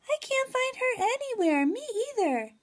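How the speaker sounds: put-on high excited kids searching; child voices. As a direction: call out like kids hunting a friend. Kids playing hide and seek.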